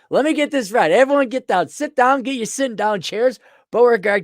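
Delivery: high, loud mock Southern outrage; hammed-up Southern fluster, calling the room to sit; exaggerated high-pitched Southern accent; Southern yokel. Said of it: "a Southern yokel".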